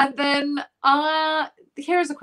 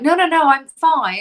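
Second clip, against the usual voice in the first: mock insistent, a bit higher; hammed-up brave denial; brushing it off; 4 semitones higher, 4 dB louder than usual. Host imitating an injured actor, mock insistent denial. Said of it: "and then you're like".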